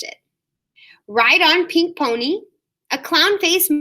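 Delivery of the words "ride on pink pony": plain reading voice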